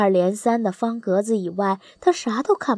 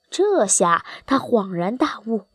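ordinary delivery

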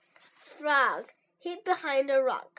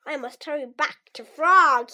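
louder urgent call; crying out; 7 dB louder than usual. A narrator's reading. Toad hurrying with melting ice cream, calling out louder. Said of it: "he cried".

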